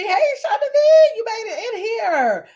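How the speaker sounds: high squealed hello, stretched out; excited greeting. Real, not acted. Host welcoming a viewer into the live chat, squealing. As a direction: sing out the welcome like a long-lost friend.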